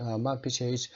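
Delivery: usual speaking voice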